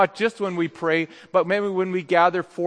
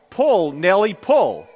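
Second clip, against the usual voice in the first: half-raised farmer's command to a horse; mild storyteller's yell; 5 dB louder, slower than usual. Acted. Farmer urging his horse to haul the car, mildly yelled. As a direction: call it out firmly, a bit louder. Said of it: "and yelled".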